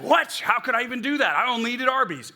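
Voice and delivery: loud, high-pitched indignant protest; exaggerated incredulous outburst; mock disbelief. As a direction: act comically appalled by the very idea. Mocks a baffled friend.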